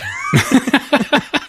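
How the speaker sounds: high burst of laughter; high-pitched giggle; real laugh. Real, not acted. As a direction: crack up at his own bit. Laugh after acted doubt.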